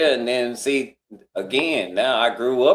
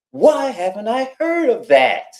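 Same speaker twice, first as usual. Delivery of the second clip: high, slow, baffled mock voice of the lady; puzzled lady; 10 semitones higher, 5 dB louder than usual. Mimicking the lady at the table, high and baffled. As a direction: ask it like a puzzled lady who can't believe she missed it.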